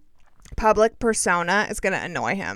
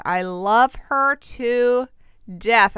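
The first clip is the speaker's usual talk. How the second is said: slow, stretched-out I love her to, then a louder death; drawn-out gush; 3 semitones higher, 4 dB louder, much slower than usual. Real, not acted. Host gushing about Jen Shah, slow and stretched out. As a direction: savor every word like a swoon.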